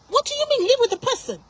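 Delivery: high-pitched, outraged disbelief; escalated indignation. Real, not acted. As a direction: pitch leaps up as the challenge is repeated. Repeats the challenge, escalating.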